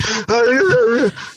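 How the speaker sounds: imitating a breathless fighter's gasp; mock post-fight gasp, high; gasping for breath. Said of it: "they're just, like, so out of breath".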